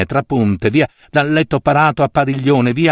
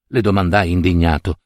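usual speaking voice

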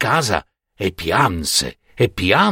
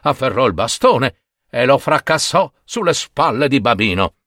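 plain reading voice

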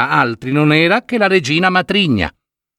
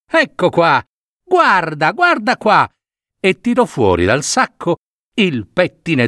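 put-on higher, coaxing old woman's voice; disguised old woman; 3 semitones higher than usual. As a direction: wheedle the girl to open up. (la vecchia finta tenta Biancaneve, voce acuta e suadente)

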